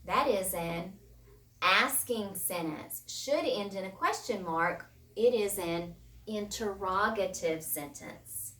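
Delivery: ordinary talk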